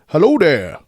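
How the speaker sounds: high, loud put-on cartoon dad voice; Chef's dad impression. Answering a scam caller as a cartoon dad, put-on voice. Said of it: "my best impression of Chef's dad from South Park".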